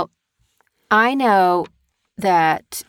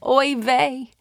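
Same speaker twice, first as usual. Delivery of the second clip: a lamenting Oy vey, pitched up a bit; woeful oy vey; 4 semitones higher than usual. Shown as a demonstration. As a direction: sigh it out like a little lament. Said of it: "Like that".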